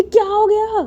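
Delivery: high, loud startled query; alarmed question. Acted. Carer reacting to Maya's cry, alarmed. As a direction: jump at the sudden squeal.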